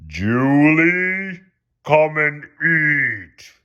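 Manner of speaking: calling from down the street; mock distant yell; far-off yell. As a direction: call her name from far away, slow. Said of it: "someone yelled".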